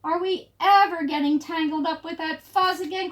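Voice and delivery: high, loud rallying question; crying out. A narrator's reading. Pipsqueak asks the crowd about the fuzz, crying out. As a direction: call it out high to the whole crowd. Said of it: "cried Pipsqueak".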